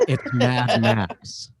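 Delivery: very slow, stretched-out words; sarcastic guess. Acted. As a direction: spell it out with exaggerated slowness. Sarcastic guess at own favorite movie.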